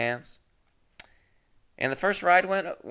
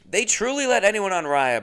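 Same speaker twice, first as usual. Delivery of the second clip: ordinary delivery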